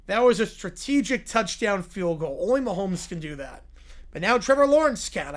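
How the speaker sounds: normal talking voice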